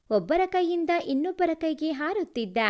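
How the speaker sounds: plain reading voice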